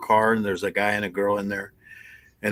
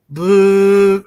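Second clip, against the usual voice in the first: kazoo toot; 10 semitones higher, 7 dB louder than usual. Retold or imitated. Blowing the kazoo test.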